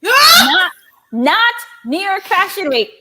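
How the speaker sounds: high, loud stressed not; announcing Paris, not New York; excited reveal. Retold or imitated. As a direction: shout the twist. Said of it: "they said".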